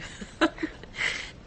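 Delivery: soft breathy teasing laugh; light airy chuckle; breathy giggle. Acted. Teasing Bernard.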